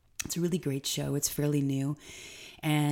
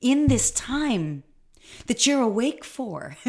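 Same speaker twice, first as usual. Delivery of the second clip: higher and louder, urgent and emphatic; intense Marianne Williamson; 6 semitones higher, 5 dB louder than usual. Retold or imitated. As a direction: echo her fervent challenge, pressing each question. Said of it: "she got quite intense".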